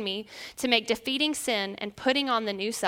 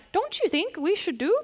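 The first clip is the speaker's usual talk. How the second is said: put-on Northern accent; 7 semitones higher than usual. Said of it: "I was saying things like".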